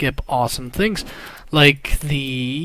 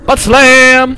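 loud, higher battle cry; gleeful attack call; 9 semitones higher, 13 dB louder than usual. Real, not acted. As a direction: shout it as you slam down. Calling out ground-slam attack.

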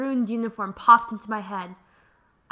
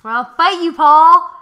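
shouted name, much louder; mock battle cry; 4 semitones higher, 14 dB louder than usual. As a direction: yell a joking challenge.

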